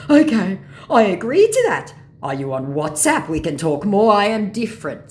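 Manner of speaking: loud, high caricature scammer; eager mock scammer. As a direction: push the offer with loud eagerness. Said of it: "says my scammer".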